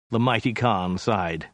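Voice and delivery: plain reading voice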